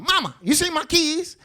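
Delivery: high pleading call; calling to mama. Pretend. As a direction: whine up to mama for help.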